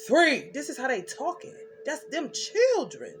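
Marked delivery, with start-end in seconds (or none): 0.1-0.5 loud, commanding count down; authoritative countdown. Retold or imitated. Children threatening at the door, counting down loud and commanding. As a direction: bark the countdown with full authority. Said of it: "How you hear the authority in my voice?".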